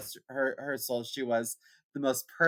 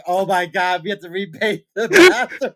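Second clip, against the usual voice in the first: louder, breaking into laughter; laughing; 6 semitones higher, 12 dB louder than usual. Retold or imitated. Mom discovering the bathroom, said through laughter. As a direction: let the laugh take over the last words.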